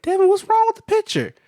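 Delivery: high, loud imitation of granny; complaining granny. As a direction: call out a mock complaint to a grandchild. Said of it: "she was complaining about her T V".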